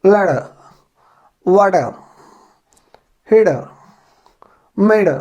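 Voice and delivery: British accent, ट half swallowed in "लड़" and "हिड". Shown as a demonstration. Teacher showing students the British way, British accent. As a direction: drop the middle t in "वाटर", British accent. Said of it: "British accent में इसको बोला जाएगा तो इस प्रकार से".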